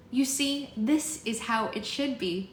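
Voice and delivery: put-on high, slow girl leader; earnest young leader voice; Bristol, addressing the room.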